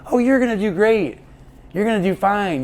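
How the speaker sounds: high, bright-sided mock cheer, fading at the end; real positive. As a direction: imitate her upbeat brush-off. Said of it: "she kept doing this real positive".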